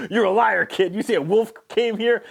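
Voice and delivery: in her face, hammed-up; aggressive dad. Farm dad accusing the girl of lying, mock aggression. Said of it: "gets in her grill".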